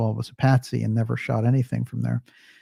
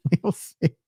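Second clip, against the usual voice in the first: laughing; 6 semitones higher than usual. Real, not acted. Laughs while repeating the line.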